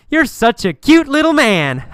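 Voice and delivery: high, loud, taunting sneer with laughter; hammed-up condescending mockery; mocking laugh. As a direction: tease him like a baby, then laugh. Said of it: "laugh and say".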